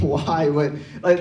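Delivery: ordinary talk, nothing marked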